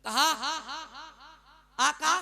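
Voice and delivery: loud, driving sermon storytelling; impassioned preaching. Acted. Preacher telling the congregation of Salba's repentance, impassioned preaching. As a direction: preach the tale with rising fervour.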